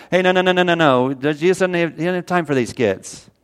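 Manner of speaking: mock-stern gatekeepers; disciples shooing away. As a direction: wave the parents off. Disciples turning people away.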